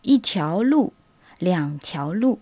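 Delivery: yi tiao lu, liang tiao lu in Mandarin tones; Mandarin phrases. Shown as a demonstration. Teacher models counting roads in Mandarin. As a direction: say both Mandarin phrases carefully. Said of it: "nous disons".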